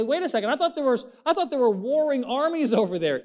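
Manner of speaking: high, fast doubting listener voice; mock objection. Preacher voicing a doubting churchgoer, high and quick. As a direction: jump in like a puzzled skeptic. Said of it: "You say".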